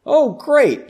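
high, loud "Oh, great"; excited exclamation. Acted. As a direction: burst out with delight. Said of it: "Shouldn't that one be exclaimed?".

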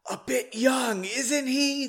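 high, airy stage whisper; hushed but projected whisper. A narrator's reading. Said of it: "the general whispered to Margot".

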